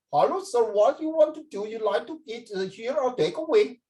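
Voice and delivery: high, put-on accent from India; Indian accent. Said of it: "But from India".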